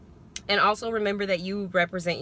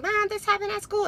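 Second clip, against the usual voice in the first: put-on child voice; 12 semitones higher than usual. Child voiced telling mom.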